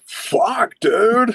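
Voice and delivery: very high, stretched-out exclamation; comic alarmed cry. Retold or imitated. Teen getting beaten up, high comic cry. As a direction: wail it in mock distress. Retold reaction while being beaten.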